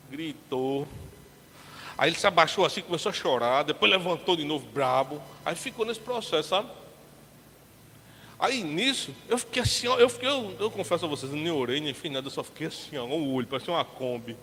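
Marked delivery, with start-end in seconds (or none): none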